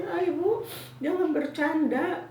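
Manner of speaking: high, loud mild protest; lightly acted nurse's chiding; disbelieving nurse. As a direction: brush it off as a joke. Acted nurse scolding disbelieving patient.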